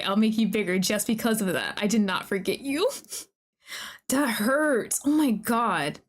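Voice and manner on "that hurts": ordinary talk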